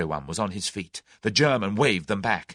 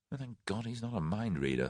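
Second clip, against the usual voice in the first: inner thought; 10 dB quieter than usual. A narrator's reading. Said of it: "thought Tommy".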